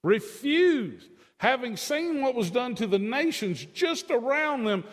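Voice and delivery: high, loud, rising to a shout; fiery pulpit climax ending in a shouted why; impassioned preaching. Real, not acted. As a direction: cry out in exasperation.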